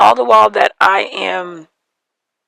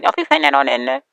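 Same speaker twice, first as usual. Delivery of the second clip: put-on quick, higher teen sass; imitating daughter; 6 semitones higher, much faster than usual. Daughter teasing her mom, quick and higher. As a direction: toss it off like an eye-rolling daughter. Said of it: "she's probably gonna be like".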